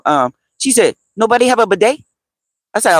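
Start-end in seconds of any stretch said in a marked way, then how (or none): 1.2-2.0 high, questioning Egyptian-accented mimicry; imitating Egyptian woman. Egyptian lady asking about toilet paper alternatives, imitated accent. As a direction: ask it as the Egyptian lady, voice lifted. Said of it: "That's how she talked".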